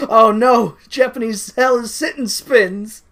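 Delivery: loud, high, slow mock horror. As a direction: cry out in fake dismay.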